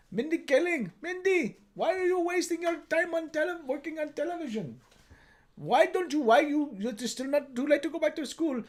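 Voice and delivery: put-on nagging parent in an Indian accent; lilting put-on Indian accent. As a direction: plead and nag as an Indian parent. Acts Mindy's Indian parent.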